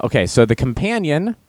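ordinary delivery

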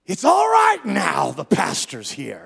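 drawn-out high scream, easing off; imitated scream. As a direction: scream it slowly as the possessed man. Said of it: "He screamed".

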